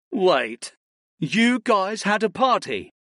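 playfully indignant, slowed; mock offended. A narrator's reading. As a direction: fake outrage at being left out. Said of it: "Almasi sniffed mockingly".

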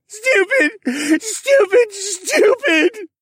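high, loud crying outburst; loud sobbing character cry; tearful self-chastising. A narrator's reading. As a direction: berate yourself, wailing in a high voice. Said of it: "he chastised himself again".

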